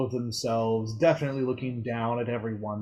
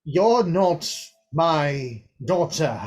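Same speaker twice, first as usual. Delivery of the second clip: louder, slow cold denial; stern father; 5 semitones higher, 5 dB louder, much slower than usual. Acted. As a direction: deny her, slow and hard. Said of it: "says the father".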